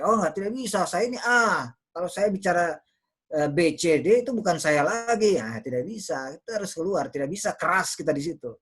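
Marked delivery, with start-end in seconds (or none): 0.0-5.4 high, louder protest; indignant objection, voice raised; stubborn refusal. Acted. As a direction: play someone digging in their heels. Acts out person refusing to change.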